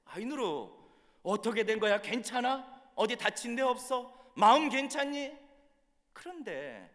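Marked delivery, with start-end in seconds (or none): 0.0-5.4 raised, urgent voice of concern; worried questioning. Retold or imitated. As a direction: anxiously check she's all right, louder and higher. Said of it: "너무나도 걱정이 돼서". (걱정되어 높고 크게 다급히 묻는 목소리)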